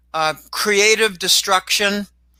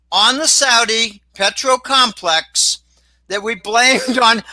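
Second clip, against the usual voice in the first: ordinary talk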